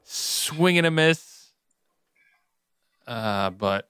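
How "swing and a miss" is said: higher, slow stretched-out call; drawn-out verdict. Real, not acted. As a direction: call the miss, slowly and drawn out. Teasing call on a failed joke.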